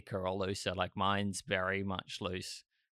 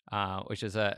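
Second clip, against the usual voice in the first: ordinary delivery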